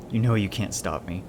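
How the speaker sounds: plain reading voice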